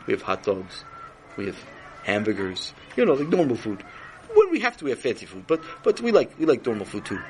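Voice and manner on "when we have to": high emphasis. Acted. King explains.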